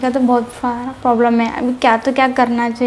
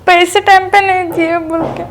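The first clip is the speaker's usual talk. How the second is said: tearful words, a touch higher; crying; 4 semitones higher than usual. Real, not acted. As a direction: speak through tears. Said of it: "क्यों रो क्यों रहे हो".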